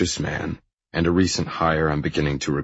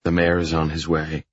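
ordinary delivery

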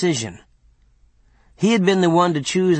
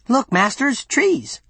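much higher-pitched eager cry; excited calling out; 10 semitones higher, slower than usual. Acted. Servant spotting trees for his masters, excited high exclamation. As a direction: point out a thrilling sight to your masters.

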